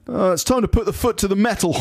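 high, put-on colleague voice; blustering office chap. Reading a list of office malapropisms, high character voice. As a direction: deliver each mangled saying as the chap. Acts out office chap's phrases.

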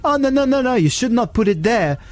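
high, excitable Italian impression; mock Italian accent. Italian cyclist warns about bike thieves, mock Italian accent. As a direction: warn urgently in a hammed-up Italian voice. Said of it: "he was an Italian chap".

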